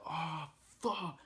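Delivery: high shriek of panic; retold scream. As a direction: shriek it like the pain just hit. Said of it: "I just started screaming".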